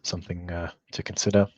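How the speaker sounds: normal talking voice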